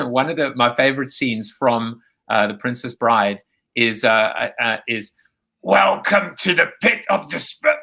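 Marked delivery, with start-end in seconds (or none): 5.6-7.8 lightly voiced menacing welcome, a touch higher; a hint of a sinister host greeting; movie line quote. Host quoting a favorite film line, lightly voiced sinister welcome. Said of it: "one of my favorite lines".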